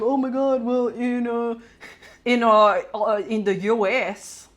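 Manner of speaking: faltering mock Australian accent; a halting, half-hearted try at an Australian accent. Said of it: "I can't do an Australian accent".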